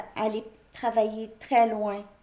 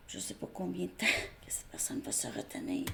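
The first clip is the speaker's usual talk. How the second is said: quick hushed whisper with a little laugh; chuchoté; 2 semitones lower, 7 dB quieter, faster than usual. Real, not acted. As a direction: whisper it fast, as a confiding aside. Unvoiced aside about the waiting person.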